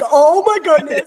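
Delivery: high, loud burst of joyful disbelief; overjoyed gasp. Retold or imitated. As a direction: blurt it out, overwhelmed with pride. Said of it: "I was like".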